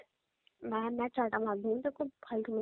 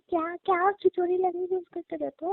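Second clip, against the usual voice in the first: higher and louder telling-off; raised, exasperated rebuke; scolding; 6 semitones higher, 5 dB louder than usual. Acted. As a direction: scold him for his killing talk. Scolding him about killing.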